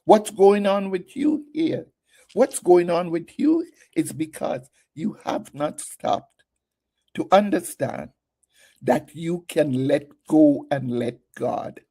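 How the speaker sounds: ordinary talk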